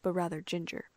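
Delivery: ordinary delivery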